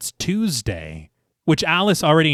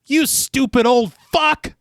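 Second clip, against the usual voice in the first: high, loud cabbie's curse; angry cursing; 10 semitones higher, 5 dB louder than usual. A narrator's reading. Taxi driver to the man he nearly hit, cursing. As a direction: curse him out, pitched up high.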